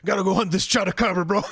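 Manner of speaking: loud, put-on bro voice; mock macho party member urging a hunt; bro alpha male. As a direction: play the pushy alpha hunter. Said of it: "he's like".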